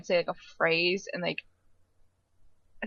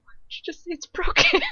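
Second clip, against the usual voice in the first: exasperated; 11 semitones higher, 4 dB louder than usual. Retold or imitated. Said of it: "It's like".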